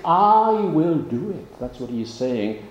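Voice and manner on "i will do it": "I" raised and slowed, rising; emphatic I. Shown as a demonstration. Preacher showing Joseph's personal promise, emphatic "I". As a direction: lean hard on "I" in "I will do it". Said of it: "here is emphatic".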